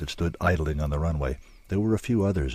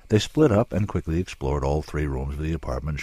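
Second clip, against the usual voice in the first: plain reading voice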